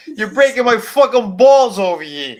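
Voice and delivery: loud, high New Yorker shout; New York accent. Pretend. Answering a request to sound like a New Yorker, mock-indignant New York accent. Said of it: "Say it like a New Yorker".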